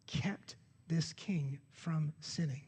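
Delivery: slow, breathy whisper for emphasis. Real, not acted. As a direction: let the words sink in, barely voiced. Preacher drops voice for emphasis.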